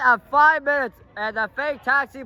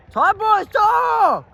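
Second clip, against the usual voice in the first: calling out; 10 semitones higher, 5 dB louder, slower than usual. Real, not acted. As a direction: shout it, higher and louder. Calling time to players.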